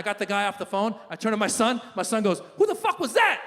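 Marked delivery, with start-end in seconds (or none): 2.6-3.4 put-on high-pitched kid outrage; sharp, high little-boy question; indignant young son. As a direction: demand it like a cursing child. Eight-year-old son reacts.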